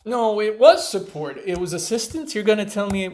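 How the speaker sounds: loud, frustrated insistence; indignant protest. Real, not acted. Learner arguing loudly with the quiz app that marked her wrong. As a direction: object sharply to the app.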